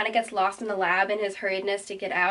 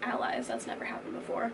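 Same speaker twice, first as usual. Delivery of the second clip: hushed surprise; 8 semitones lower, 7 dB quieter than usual. Retold or imitated. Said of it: "And he's like".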